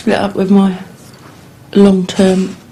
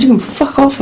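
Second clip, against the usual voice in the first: retold angry dismissal; 7 semitones higher than usual. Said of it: "I said".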